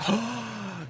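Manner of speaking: big, hushed theatrical gasp; exaggerated sharp intake of breath; shocked mock gasp. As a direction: gasp as if horrified. Acting shock at wife's answer.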